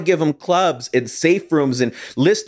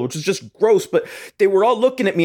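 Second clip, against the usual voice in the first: ordinary delivery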